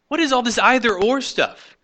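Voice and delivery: exasperated, disbelieving challenge. Real, not acted. Exasperated rebuttal of critics.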